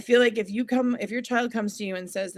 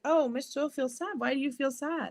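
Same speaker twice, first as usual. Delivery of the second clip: higher-pitched imitation of a pupil; small child's voice, lightly acted; sympathetic child; 6 semitones higher than usual. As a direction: ask like a concerned little kid. Acts out children asking teacher.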